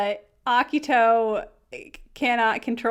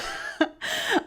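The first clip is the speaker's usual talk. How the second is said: giggle; 9 semitones higher, 3 dB quieter than usual. Real, not acted. Giggle after her ta-da.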